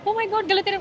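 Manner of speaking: high, theatrical mock gasp of shock; hammed-up shock. Teasing her brother, showing him a fake shocked overreaction. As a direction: overact the wrong-airport panic. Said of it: "over react करते".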